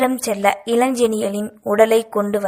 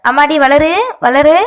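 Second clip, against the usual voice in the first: higher, louder call through a door; urgent raised-voice calling; shouting; 5 semitones higher, 7 dB louder than usual. A narrator's reading. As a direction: shout anxiously for her daughter. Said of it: "கத்த".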